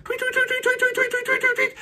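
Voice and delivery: very high chirping tweets; high bird tweets. A narrator's reading. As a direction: chirp it like a flock taking off. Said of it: "the birds, they all flew".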